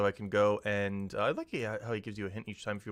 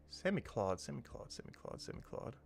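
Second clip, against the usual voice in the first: softer each time, then whispered; fading to whisper; 12 dB quieter than usual. Real, not acted. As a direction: let the name fade into a whisper. Repeating name, fading to whisper.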